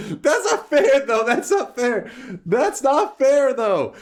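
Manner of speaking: high, loud whining complaint; whiny protest. Real, not acted. Streamer whining to chat about the call, high and loud.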